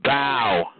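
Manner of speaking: ordinary talk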